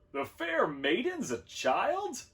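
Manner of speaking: high, drawn-out disbelief; astonished question. A narrator's reading. Large learns maiden is a baby.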